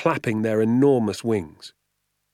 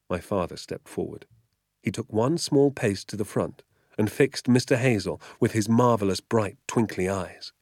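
ordinary delivery

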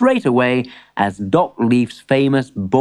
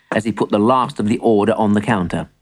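ordinary delivery

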